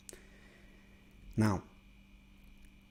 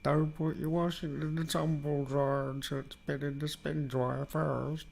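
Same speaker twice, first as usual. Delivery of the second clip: preachy character voice; 4 semitones higher, 3 dB quieter than usual. Retold or imitated. Imitating preachy soap character.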